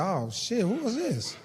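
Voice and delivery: hushed, startled aside; scared under the breath. Retold or imitated. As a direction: mutter it nervously to yourself. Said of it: "I said".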